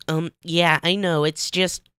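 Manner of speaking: high, bright cartoon sponge voice; SpongeBob voice. Narrator voicing SpongeBob to Patrick, high cartoon sponge. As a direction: play SpongeBob, distracted and dreamy.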